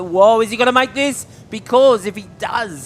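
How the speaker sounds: high, loud live call; rising thrill at the finish; excited. Real, not acted. Wave nearly made, live call.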